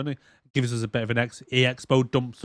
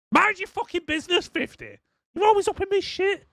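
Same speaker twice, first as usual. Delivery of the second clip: very high squealed comic retort; irritated character voice. Retold or imitated. Gunner telling fifty to back off, high indignant squeal. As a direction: squeal it indignantly, far above your own voice.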